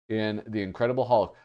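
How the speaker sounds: normal talking voice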